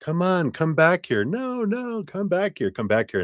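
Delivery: high pleading calls; anxious partner chasing, mock pleading; pleading chaser. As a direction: beg them to come back, high. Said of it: "if we chase".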